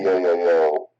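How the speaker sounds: muffled, as if behind a hand; muffled mic. Real, not acted. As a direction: voice dulled and boxy. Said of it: "Said your voice is muffled when you're talking".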